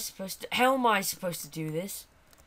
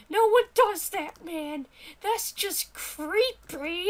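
high-pitched scandalised character; high, indignant squawk; disgusted sidekick voice; 10 semitones higher, 4 dB louder, slower than usual. Acted. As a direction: protest in a high little voice.

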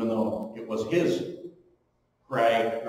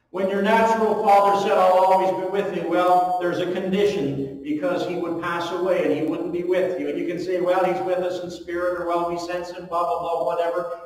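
louder, higher preaching push; 6 semitones higher, 5 dB louder than usual. Acted. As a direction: drive it home to the congregation. Preaching climax.

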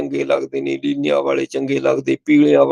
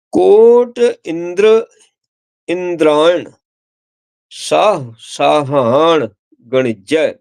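scripture recitation; much slower than usual. Acted.

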